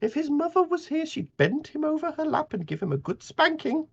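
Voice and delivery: put-on wag a finger at the boy in a high voice; Miss Polly's voice. Miss Polly threatens spanking.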